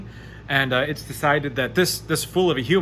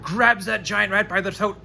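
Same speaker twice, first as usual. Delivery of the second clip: ordinary talk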